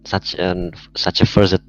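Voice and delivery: ordinary talk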